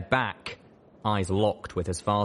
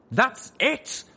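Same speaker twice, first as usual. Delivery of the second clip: angry outburst; 4 dB louder than usual. Acted. Father snaps.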